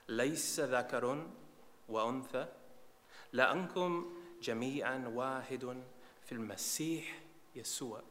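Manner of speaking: Arabic verses read out with long pauses between phrases; solemn public reading of scripture. Acted. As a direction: recite the verse phrase by phrase to the congregation. Reads Galatians aloud in Arabic.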